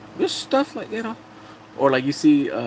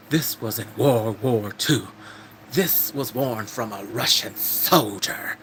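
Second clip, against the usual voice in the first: slow, weighty, drawn-out mock drama; grandiose storytelling; 2 semitones lower, slower than usual. Man telling his clothing's war history, mock-solemn and slow. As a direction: stretch each key word like a legend.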